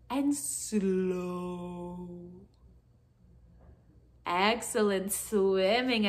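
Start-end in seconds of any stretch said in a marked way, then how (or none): 0.1-2.1 long, lowered, stretched-out word; drawn-out slow. Shown as a demonstration. Reader slows the children's wiggling hands, drawn out.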